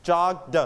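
"jogged" as jog-duh; separated final d. Shown as a demonstration. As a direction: release the d of "jogged" as its own sound. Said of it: "we pronounce the".

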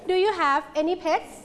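slow model of "Do you have any pets", ending on a rise; measured "Do you have any pets", pitch climbing on "pets"; rising question tune. Shown as a demonstration. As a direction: lift "pets" at the end for learners to copy. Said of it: "[name]ขึ้นเสียงสูง".

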